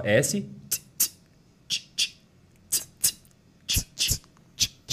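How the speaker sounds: soft whispered "tch" beats; unvoiced "tch" hi-hat. Shown as a demonstration. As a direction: breathe out "tch" with no voice. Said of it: "Isso é S X".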